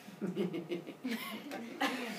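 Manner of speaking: quiet, sinister mock snicker; long, hushed villain chuckle; creepy giggle. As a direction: giggle softly like a creepy schemer. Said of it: "you're a little on the creepy side".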